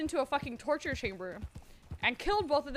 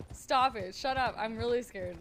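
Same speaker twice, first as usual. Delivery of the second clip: usual speaking voice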